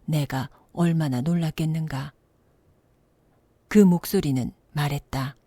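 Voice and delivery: ordinary delivery, nothing marked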